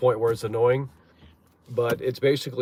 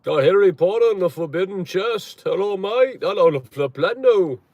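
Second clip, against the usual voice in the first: higher, hammed-up British accent; mock British accent; 8 semitones higher, 7 dB louder than usual. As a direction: put on a silly British "hello, mate" voice.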